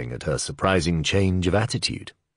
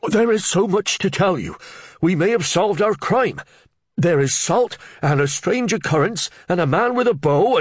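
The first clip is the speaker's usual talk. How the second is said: much higher, louder breathless blurting; excited Reeve; 14 semitones higher, 5 dB louder than usual. Acted. Flustered Reeve blurting news to the under-sheriff, much higher and louder. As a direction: tumble the news out, over-excited.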